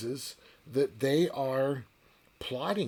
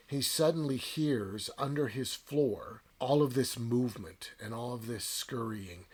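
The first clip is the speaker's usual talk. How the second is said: normal talking voice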